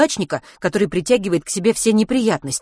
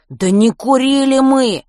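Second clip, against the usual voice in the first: slow, loud, higher shout; boy shouting after the teacher; 8 semitones higher, 8 dB louder, slower than usual. A narrator's reading. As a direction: yell it after them. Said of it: "крикнул им вслед Матвей".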